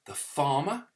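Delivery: usual speaking voice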